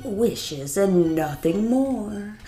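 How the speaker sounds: slow, ominous genie voice. Acted. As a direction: lay down the warning slowly. Genie speaks slowly, ominously.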